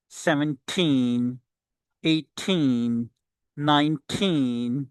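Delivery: put-on THIRTEEN to NINETEEN with a long, falling TEEN; stressed TEEN. Shown as a demonstration. Teacher drills the numbers, stressing TEEN. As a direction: split each number and lean on TEEN. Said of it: "so I am stressing the word".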